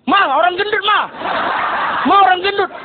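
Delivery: put-on loud, high child's yell; a child yelling to his mother about a stranger; child shouting. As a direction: shout it out like a bratty kid pointing. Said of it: "dia berteriak".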